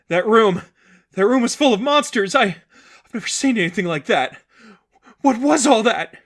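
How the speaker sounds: high, loud frightened voice; panicked. Acted. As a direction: shaken and terrified. Sadie panicking in darkness.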